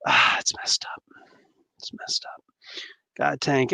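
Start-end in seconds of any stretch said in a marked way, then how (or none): 0.1-2.4 unvoiced, sighing near-whisper; hushed dismay. Real, not acted. Streamer reacting to a film delay, hushed dismay. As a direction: let it sink to a whisper.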